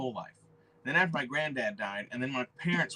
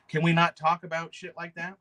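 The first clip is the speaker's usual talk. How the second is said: ordinary talk, nothing marked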